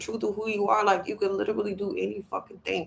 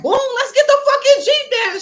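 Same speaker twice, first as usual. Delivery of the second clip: much higher, louder triumphant burst; thrilled yes; 8 dB louder than usual. Retold or imitated. She answers the saleslady, much higher and louder thrilled cry. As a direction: shout it like you just won. Her thrilled reply to the price.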